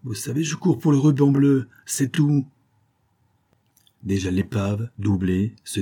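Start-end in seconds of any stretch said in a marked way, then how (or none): 0.0-2.5 dismissive. Acted. Captain rebuffs priest.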